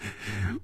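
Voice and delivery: soft short chuckle; laugh. A narrator's reading. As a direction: chuckle quietly in character. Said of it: "红军也笑了".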